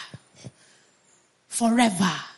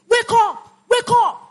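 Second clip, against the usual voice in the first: high-pitched preacher's call; rousing shout; 9 semitones higher, 4 dB louder than usual. Real, not acted. Preacher urging weak soldiers to rise, high rousing shout. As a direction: cry it out to rouse them.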